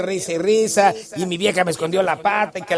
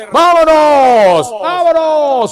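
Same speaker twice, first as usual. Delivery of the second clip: hype shout; 8 semitones higher, 8 dB louder, much slower than usual. Real, not acted. Radio host hyping the music.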